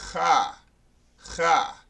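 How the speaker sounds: Cha with a throat-scraped CH; throaty Cha. Shown as a demonstration. Teacher showing how the Hebrew word is pronounced, throaty Cha. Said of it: "that is how you pronounce it".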